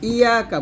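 ইয়াকা quick, with single undoubled y; mispronounced ইয়াকা. Shown as a demonstration. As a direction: rush ইয়াকা without the doubling. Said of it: "তাসদিদের উচ্চারণটা আমরা এখানে করি না".

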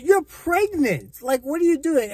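high-pitched, incredulous scolding; exasperated disbelief. Real, not acted. Reviewer scolding a film character for quitting her job, exasperated.

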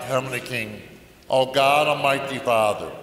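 usual speaking voice

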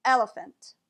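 "Elephant" with second and third vowels as uh; schwa in elephant. Shown as a demonstration. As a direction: say "Elephant" as EL-uh-fuhnt. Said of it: "Listen to this one more time".

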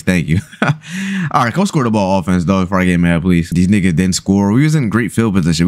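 normal talking voice

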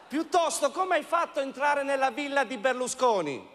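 ordinary talk, nothing marked

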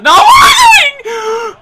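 very high, long, loud shriek; outraged scream. Real, not acted. Gamer furious at the play, outraged scream. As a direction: scream it in disbelief. No offside given.

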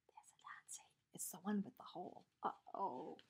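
whispered aside to the listeners; hushed aside. Acted. Narrator whispering to the children, hushed aside. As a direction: lean in and whisper the secret.

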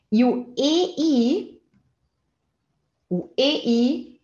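ordinary talk

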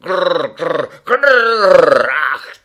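high, loud mock tiger growls; a boy in a tiger mask scaring the children. A narrator's reading. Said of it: "making terrible growling noises".

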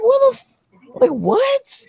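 very high, squeaky mock shock; stunned outburst. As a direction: yelp it out in fake disbelief. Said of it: "I was like".

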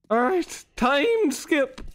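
usual speaking voice

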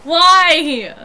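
loud, upset protest; indignant outburst. Real, not acted. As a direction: cry out in indignation. Indignant outburst over insult.

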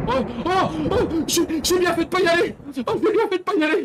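high, loud, fast panicked yelping; real fright spilling out in a rush; panicked relief. Real, not acted. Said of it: "J'ai envie de pleurer".

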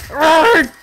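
loud high cry of pain; pained arrgh yell. Real, not acted. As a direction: yell out as the blade hits. Stabbed in the hand.

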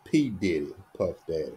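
normal talking voice

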